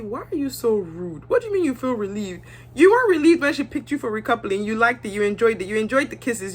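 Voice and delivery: ordinary talk, nothing marked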